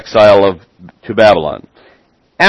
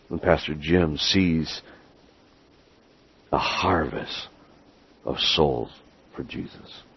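earnest prayer; 6 semitones lower, 9 dB quieter than usual. Real, not acted. Leading the congregation in prayer for Pastor Jim, hushed and reverent. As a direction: pray quietly, leaving space between phrases.

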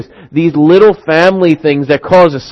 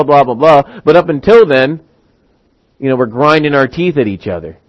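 ordinary delivery

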